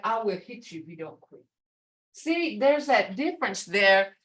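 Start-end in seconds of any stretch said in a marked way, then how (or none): none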